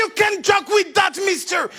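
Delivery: mock English guard scolds; guard's scolding. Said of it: "Il m'a dit".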